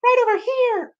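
tiny, very high character voice; very high falsetto reply. Acted. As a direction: pipe up in falsetto. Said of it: "a falsetto voice spoke".

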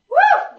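a high, loud cheering whoop; excited whoop. Real, not acted. Instructor whooping at the class mid-set, loud and high.

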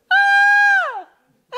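loud, very high-pitched "aah" wail; baby's cry. Retold or imitated. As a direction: scream out like a hungry newborn. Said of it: "מה הוא צועק?". (צרחה גבוהה של תינוק רעב)

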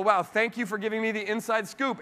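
high-pitched mock gratitude; really grateful. Newcomer thanking colleague for inside scoop, acted gratefulness. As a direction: gush thanks, acting the eager newcomer. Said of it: "you're really grateful".

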